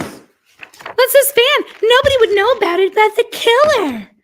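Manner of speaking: high, loud hammed-up exclamation; mock outcry. Streamer mocking the van evidence with a hammed-up shriek. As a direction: shout it way up high in a put-on voice.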